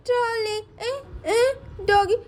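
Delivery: put-on high toddler voice; short, high little-child commands; small child shooing. Munna shoos the dog from his trolley, high toddler voice.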